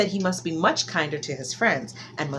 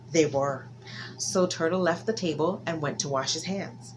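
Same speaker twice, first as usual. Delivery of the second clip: ordinary delivery, nothing marked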